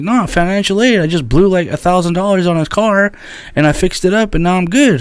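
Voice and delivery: imitating a friend's boast; mock friend voice. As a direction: play the carefree friend. Friend explaining, retold.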